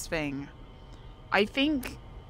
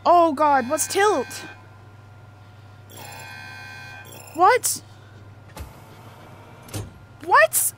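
high, loud frightened yelps; scared shriek of what; panicked; 8 semitones higher, 4 dB louder, slower than usual. Real, not acted. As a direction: yelp in fear and confusion. Panicked at something on screen.

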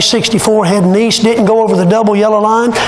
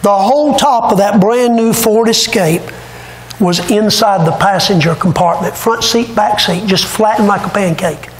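ordinary talk, nothing marked

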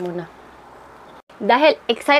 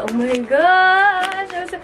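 excited; 7 semitones higher, 4 dB louder, much slower than usual. Real, not acted. Marvelling at the packaging.

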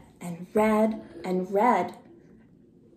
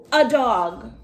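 snickering; 4 semitones higher than usual. Acted. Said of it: "He snickered and said".

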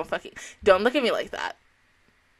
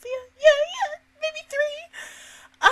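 high crying squeak; much slower than usual. Real, not acted. Admits crying again, voice cracking.

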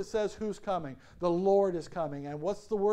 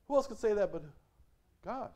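ordinary talk

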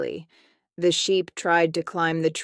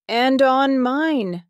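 high, drawn-out storybook cry; barked; 8 semitones higher, 4 dB louder, slower than usual. A narrator's reading. Hyena joins the panicked animals, barking it out. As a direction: call out slowly, pitched well up. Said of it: "barked the hyena".

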